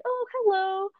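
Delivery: high, mock-polite greeting from the prof; surprised professor. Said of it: "she's like".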